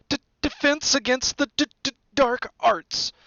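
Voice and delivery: high, reluctant nervous stutter; stammering mutter. A narrator's reading. Quirrell reluctant to name his subject, high stammer. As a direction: stumble on each hard consonant. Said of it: "muttered Professor Quirrell".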